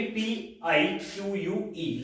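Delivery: slow letter-by-letter spelling of पीआईक्यूयूई; teacher spelling पीआईक्यूयूई aloud; spelled out letter by letter. Shown as a demonstration. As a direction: read पीआईक्यूयूई out one letter at a time, slowly. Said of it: "spelling आप देखिए".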